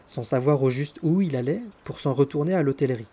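ordinary delivery

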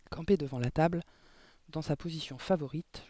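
ordinary delivery

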